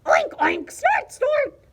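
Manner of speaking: high, loud oinks and snorts; squealing imitated pig noises; pig oinking. A narrator's reading. Pig showing off his noise to Martina, squealing oinks. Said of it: "Pig went".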